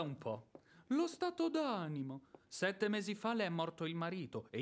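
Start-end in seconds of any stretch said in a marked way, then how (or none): 0.9-2.2 high, sneering mimicry of her phrase; scornful mockery. Smirnov sneers at widow's excuse, mocking. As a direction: sneer her words back at her.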